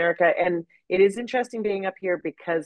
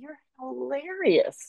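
self-mocking; 8 semitones higher, slower than usual. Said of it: "I catch myself and I go".

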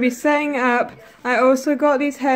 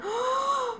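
quick high breathy gasp of surprise; sharp gasp; 13 semitones higher, 7 dB quieter than usual. Real, not acted. Teen vlogger gasps as her new phone switches on. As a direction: gasp as the screen lights up.

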